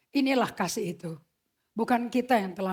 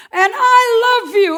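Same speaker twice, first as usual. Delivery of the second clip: loud, high, voice cracking with emotion; a cry of love from God; tearful declaration; 12 semitones higher, 15 dB louder, slower than usual. Acted. God's declaration, voice breaking.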